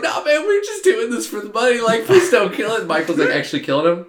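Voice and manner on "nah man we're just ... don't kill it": high-pitched, whiny begging; mock pleading. YouTubers begging the killer for their lives, high mock plea.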